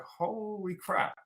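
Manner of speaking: drawn out very slowly and high in awe; amazed holy crap. Retold or imitated. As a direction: stretch it out in stunned disbelief. Client's amazed exclamation retold.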